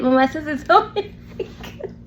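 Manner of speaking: breaking up into laughter; laughing. Real, not acted. Woman retelling her childhood cussing excuse, laughing. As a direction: laugh through the words until they fade out.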